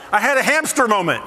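ordinary delivery

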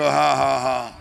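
low, deliberate ha-ha-ha; spoken laugh for the crowd to copy; fake laugh. Shown as a demonstration. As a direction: demonstrate a put-on laugh. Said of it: "A fake laugh".

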